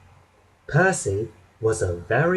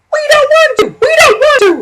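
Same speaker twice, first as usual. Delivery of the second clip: very high, loud squealing protest; grumbling trucks; 13 dB louder, much faster than usual. A narrator's reading. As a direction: squeal it like balky little trucks. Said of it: "they grumbled".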